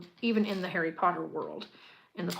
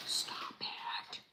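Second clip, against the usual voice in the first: breathless whispered stop it; gushing whisper; 10 dB quieter than usual. Real, not acted. Builder whispers at the cute candy in delight. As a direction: hush it like a secret delight.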